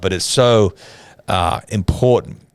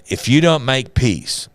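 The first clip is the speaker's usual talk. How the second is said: slow, higher-pitched retold ultimatum; firm warning; 6 semitones higher, 4 dB louder, slower than usual. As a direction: press the warning slowly, leaning on DON'T. Missionary repeats ultimatum to tribes.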